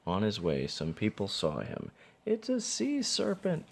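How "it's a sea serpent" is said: high, frightened cry; mock scream. A narrator's reading. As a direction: scream it like you've seen a monster. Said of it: "one of them screamed".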